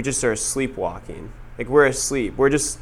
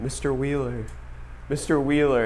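soft, faraway calling of the name; distant calling voice; 5 dB quieter than usual. Retold or imitated. Teacher's voice heard through sleep, soft distant calling. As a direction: call it as if from the edge of a dream. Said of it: "The voice was going".

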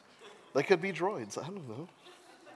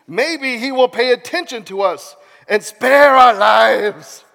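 loud captain's plea; hammed-up shouted appeal; desperate shouting; 9 semitones higher, 15 dB louder, slower than usual. A narrator's reading. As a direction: build to a big theatrical cry. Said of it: "he shouted".

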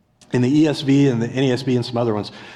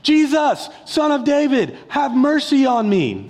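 high mock yell for mercy; retold yell; 13 semitones higher than usual. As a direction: cry it out like a blind beggar calling. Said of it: "the way that he's yelling".

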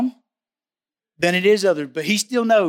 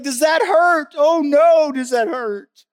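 higher, louder, anxious concern; worried Peter; 8 semitones higher, 4 dB louder, slower than usual. Retold or imitated. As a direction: gasp over the wounds. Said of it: "he was like".

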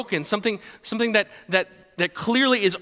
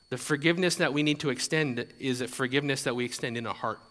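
normal talking voice

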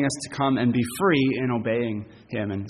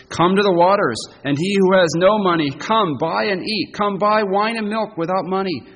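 raised-pitch pulpit appeal; impassioned preaching; 5 semitones higher than usual. Acted. Preacher urges listeners to come freely to Jesus, impassioned. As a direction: press the invitation on the congregation, pitched high.

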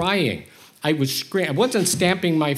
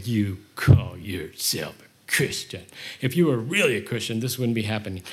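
taunting devil; 5 semitones lower than usual. Retold or imitated. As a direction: sneer it like the devil. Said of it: "The devil will be right on your case saying".

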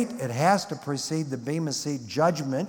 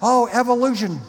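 high, loud hammed-up lament; mock cursing; 8 semitones higher, 7 dB louder than usual. Preacher mocks atheists blaming evolution, hammed-up wail. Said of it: "why don't you blame the planet".

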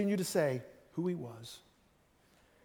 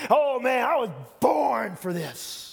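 slow, loud and much higher, showing off; louder, higher boast of glory; glory-hog shout; 5 semitones higher, 6 dB louder, slower than usual. Shown as a demonstration. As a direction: belt it like a star taking the applause. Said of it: "you get all the glory".

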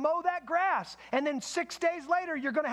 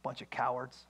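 much lower and softer under the breath; muttered aside; 13 semitones lower, 6 dB quieter than usual. Real, not acted. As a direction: grumble it half to yourself. Aside to silent congregation.

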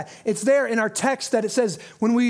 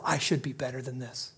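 self-reproach; 11 semitones lower, 10 dB quieter than usual. Retold or imitated. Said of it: "You're saying of yourself".

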